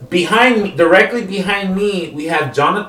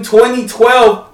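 mock scream; 6 semitones higher, 4 dB louder, slower than usual. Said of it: "the other guy screaming".

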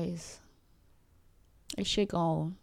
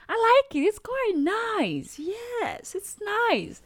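much higher, teasing herself; pleased with herself, pitched way up; playful put-on voice; slower than usual. Enjoying hearing herself speak, a high playful voice. Joking about enjoying her own voice.

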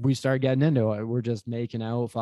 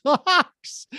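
laughing; 3 dB louder than usual. Retold or imitated. Laughs mid-quote.

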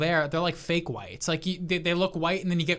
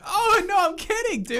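much higher, louder squeaky backpedal; panicked backpedal; 4 dB louder than usual. Retold or imitated. Caught insulting Steve, squeaky backpedal. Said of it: "I'm like".

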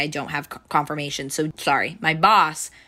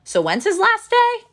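much higher put-on questioning voice; prying coworker imitation; 4 dB louder than usual. Coworker walks up behind her asking about him, prying. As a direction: pipe up high with a nosy question.

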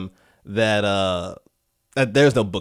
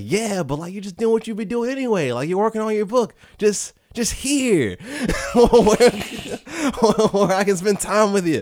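higher, lilting mom voice with laughs breaking in; a sweet mom, higher pitched and giggly; doting mom voice; 8 semitones higher than usual. Retold or imitated. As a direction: play the cheerful mother talking her son up. Mother voiced in the story.

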